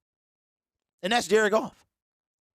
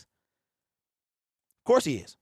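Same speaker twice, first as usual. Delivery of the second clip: slow drawn-out; 3 semitones lower, much slower than usual. Real, not acted. Host insisting to doubters that Wilson is the same, slow and drawn out. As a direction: stretch "Of course he is" out very slowly.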